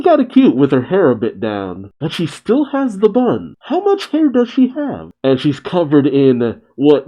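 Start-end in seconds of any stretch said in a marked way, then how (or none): 2.0-5.1 high, incredulous disbelief; amazed. Real, not acted. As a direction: squeak out the disbelief. Incredulous reaction to hair.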